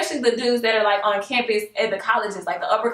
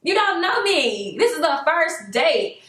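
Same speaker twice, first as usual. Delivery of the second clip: sassy warning; 6 semitones higher than usual. Retold or imitated. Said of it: "I was like".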